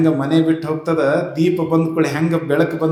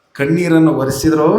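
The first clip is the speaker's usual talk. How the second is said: ordinary delivery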